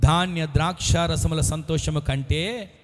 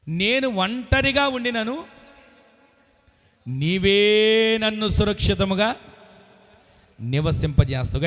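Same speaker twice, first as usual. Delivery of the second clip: solemn scripture reading; 2 semitones higher, 5 dB louder, slower than usual. Acted.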